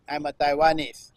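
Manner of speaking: usual speaking voice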